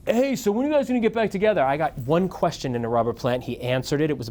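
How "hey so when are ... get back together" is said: put-on high, fast airhead kid voice; clueless kid. Retelling the kid's question to [name], high mocking voice. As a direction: play the kid as totally oblivious. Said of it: "he was like".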